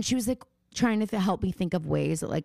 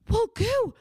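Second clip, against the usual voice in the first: high, gushing mom voice; begging mom; 10 semitones higher than usual. Retold or imitated. Daughter mimics mom begging to ship merch, high and gushing. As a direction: beg to help like an overeager mom.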